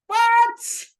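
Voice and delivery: very high, loud shriek of disbelief; shocked what. Real, not acted. Vlogger learning the couple is still together, shrieking in shock. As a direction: screech it in total shock.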